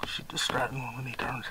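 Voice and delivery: hushed whisper so the birds won't hear; hunting whisper. Real, not acted. Hunter whispering to his son in the blind.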